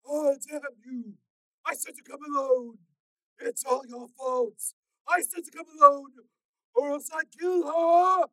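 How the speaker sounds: high, slow loud growl; strained warning. A narrator's reading. Stalker warning Steel, slow and strained. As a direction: hold him back, slowly.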